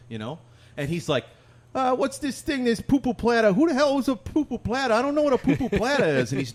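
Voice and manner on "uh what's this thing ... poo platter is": high, loud, baffled New York customer; put-on New York voice, pitched way up; New York guy. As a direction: ham up a clueless New York accent. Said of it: "be some guy from New York".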